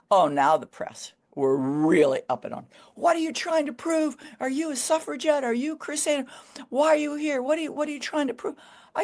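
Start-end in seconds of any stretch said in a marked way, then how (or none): 2.9-8.6 much higher, needling reporter voice; pushy reporters. Retold or imitated. Press truck hounding the woman runner, high pushy mock questions.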